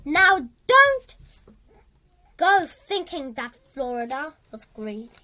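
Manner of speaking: plain reading voice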